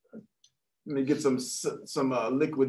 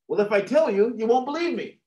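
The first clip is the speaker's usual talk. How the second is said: lightly voiced teen, pitched up; teenager; 7 semitones higher, 4 dB louder than usual. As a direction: protest to the cop in a higher voice.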